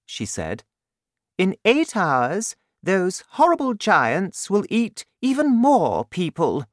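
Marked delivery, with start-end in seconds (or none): 1.4-6.7 high, regal voice of the Queen; Queen's voice. Acted. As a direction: speak up high like a worried queen.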